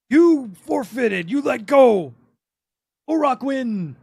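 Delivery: loud, high, drawn-out half-orc shout; half-orc calling out a verdict. Acted. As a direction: bellow it slowly like an orc at a contest. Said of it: "the half orc that's sitting there".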